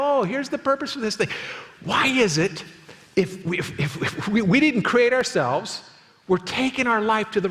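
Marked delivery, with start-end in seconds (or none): 0.0-1.3 helpful staff. Retold or imitated. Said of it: "And they go".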